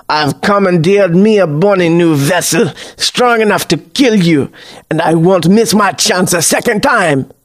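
put-on theatrical old-sailor villain voice; scornful sneer. A narrator's reading. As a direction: gloat menacingly like a vengeful seafarer. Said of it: "Jeff sneers a scornful laugh".

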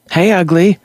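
put-on high, cheeky boy's call; a skinny boy calling out happily; little boy greeting. Boy Rat greeting his friend, high and cheerful.

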